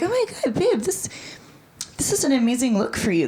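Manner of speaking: high gushing fangirl voice; gushing American. Retold or imitated. Americans gushing over the new haircut, mock gush.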